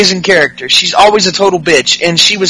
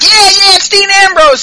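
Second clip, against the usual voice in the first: much higher, excited mock cheer; imitating Ellsworth; 12 semitones higher than usual. Host voicing Ellsworth greeting Ambrose, high mock excitement. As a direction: squeal like a starstruck fan. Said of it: "Ellsworth's like".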